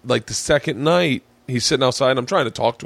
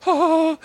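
very high, drawn-out "ah"; high mock wail. Comic surrounded by club guys, mock wail. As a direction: imitate a helpless high-pitched cry. Retold reaction to being told strip.

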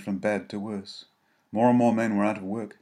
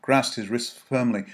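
usual speaking voice

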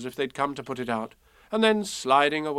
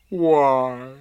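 mournful, loud donkey voice; drawn-out gloomy wondering; sad; 6 dB louder than usual. A narrator's reading. Eeyore thinking sadly to himself, sad. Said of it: "he thought sadly to himself".

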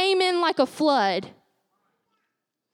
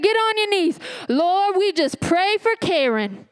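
grandma ordering; 4 semitones higher than usual. Retold or imitated. Grandma makes the family kneel, commanding. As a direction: order them down on their knees. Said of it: "She said".

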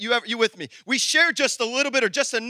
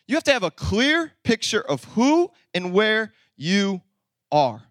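slow emphatic restatement; slower than usual. Shown as a demonstration. Preacher repeating his first point to the church, slowly and emphatically. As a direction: let "clear", "who", "where", "you", "are" each land separately.

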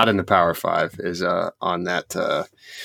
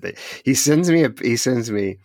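usual speaking voice

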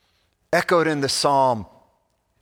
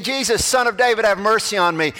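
mild, higher-pitched acted plea; pleading cry; 7 semitones higher, 3 dB louder than usual. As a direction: lightly call out like a blind man begging. Blind man calling out for mercy.

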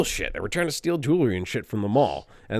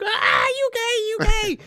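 very high-pitched squealing taunt; imitated shrieking kids; slower than usual. Kids reacting to the woman being tased, shrieking taunt. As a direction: mimic hysterical children screeching a jeer. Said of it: "all the kids are like".

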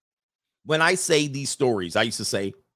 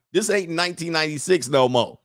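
ordinary delivery

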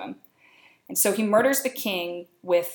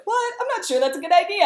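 high, fast alarmed protest; startled and backing away; shocked Macbeth; 11 semitones higher, 4 dB louder, faster than usual. Retold or imitated. Macbeth balking at killing the king, high panicky protest. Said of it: "He's like".

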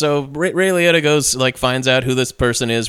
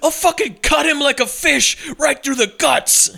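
high, menacing hammed-up thug; Sean Penn impression; 10 semitones higher than usual. Villain holding a knife to a kid, hammed-up menace. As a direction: threaten like a sweaty movie villain. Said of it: "a Sean Penn impression".

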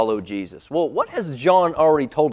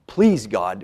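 ordinary talk, nothing marked

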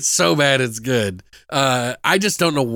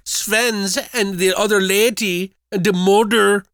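higher, mock Swedish narration; hammed-up Swedish impression, pitched up; bad Swedish accent; 6 semitones higher than usual. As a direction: slip into a silly Swedish accent. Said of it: "has the worst Swedish accent in the world".

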